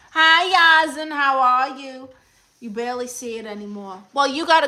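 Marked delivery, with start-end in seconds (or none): 0.1-2.1 loud, high, delighted hello; sudden loud welcome; excited greeting. Real, not acted. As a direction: greet the viewer with a burst of joy. Greeting a viewer on live.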